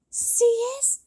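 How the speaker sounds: high, loud excited exclamation; a thrilled cry in a high voice. Acted. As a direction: burst out in excitement. Excited character outburst.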